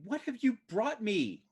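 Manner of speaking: high-pitched wail of acted distress; crying mother. Sobbing mother beats on his chest, high acted wail. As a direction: wail it in horror, pitched well up. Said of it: "starts crying, bawling her eyes out".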